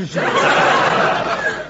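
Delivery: loud real laugh; burst of laughter. Real, not acted. Narrator laughs at what rubbish it is, loud. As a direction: laugh at the silliness.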